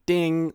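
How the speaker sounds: high, loud "ding"; imitated ding. Podcaster mocking the movie's opening narration, high loud ding. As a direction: ring it out like a bell.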